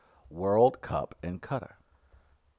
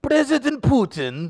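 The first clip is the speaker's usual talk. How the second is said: slow, high, loud Russian accent; bad Russian accent; 12 semitones higher, 8 dB louder, slower than usual. Shown as a demonstration. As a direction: strain through a hammed-up Russian accent. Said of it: "I could do a Russian".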